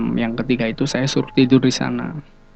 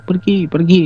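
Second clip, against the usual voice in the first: mild mock yell, higher pitched; retold shouting; 6 semitones higher than usual. Mother-in-law shooing a crying ghost away, retold mild yell. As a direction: imitate someone shouting a spirit away, only half raised. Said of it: "mertua saya sampai teriak-teriak".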